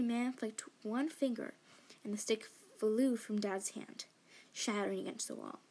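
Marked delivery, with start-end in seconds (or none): none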